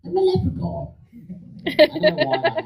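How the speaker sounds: high Irish leprechaun voice, laughing. Shown as a demonstration. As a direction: stay high in the Irish lilt while laughing. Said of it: "came up with this voice".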